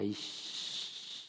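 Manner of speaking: slow, whispered curse cut short; half-whispered aborted curse; bitten-off curse. Retold or imitated. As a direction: swallow the swear before it lands. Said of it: "씨까지 나오고".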